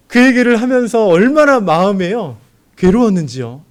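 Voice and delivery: loud, high cry of inner torment; high, loud pained sermon cry; anguished preaching. Acted. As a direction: pour out the anguish at full voice.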